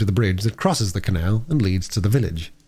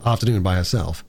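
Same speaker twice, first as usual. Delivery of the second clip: usual speaking voice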